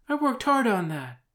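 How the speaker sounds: high, loud let-down complaint; Hermione. Acted. Hermione protesting her cancelled workshop, dismayed.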